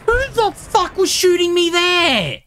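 high, furious demand; angry outburst. Real, not acted. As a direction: shout it up high in rage. Gamer shot from nowhere.

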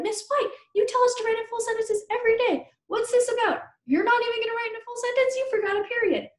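high-pitched indignant pupil; mock student complaint. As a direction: play a student calling out the teacher. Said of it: "You would be like".

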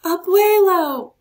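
high, loud, drawn-out call; joyful shout. A narrator's reading. Marco spotting his grandfather marching, loud joyful shout. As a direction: call across the crowd. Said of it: "Marco shouted".

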